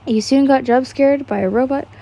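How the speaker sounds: ordinary delivery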